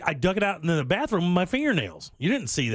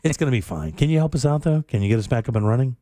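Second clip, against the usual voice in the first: low, drowsy and laid-back; very mellow; 3 semitones lower than usual. Retold or imitated. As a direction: sound half asleep and totally calm. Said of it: "it was just very mellow".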